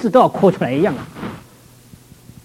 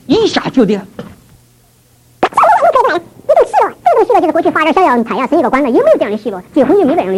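overflowing joy; 9 semitones higher than usual. Retold or imitated. Telling listeners of his joy on the stairs, high and elated. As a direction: sing out the joy you suddenly felt. Recalling sudden joy of salvation.

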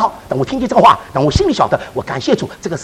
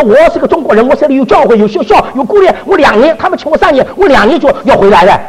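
higher and louder, insisting he will go home; a retold protest, pitched up and pushed loud; retold indignant protest; 6 semitones higher, 5 dB louder than usual. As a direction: argue your case to the consul, raised.

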